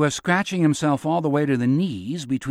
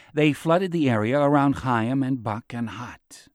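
plain reading voice

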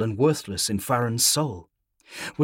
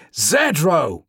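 calling out; 4 semitones higher, 5 dB louder than usual. A narrator's reading. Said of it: "Morikani's voice, and close by".

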